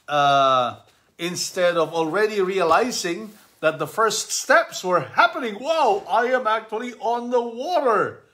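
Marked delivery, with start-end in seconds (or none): 5.6-8.2 much higher, wide-eyed wow; amazed Peter. Acted. Peter amazed to be standing on the water. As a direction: marvel at it.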